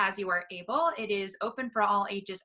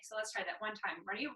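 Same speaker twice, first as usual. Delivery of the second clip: normal talking voice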